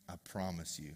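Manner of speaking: very low, soft, solemn vow; hushed mock promise. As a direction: promise it as an acted, hushed reassurance. Husband coaxing wife with promise.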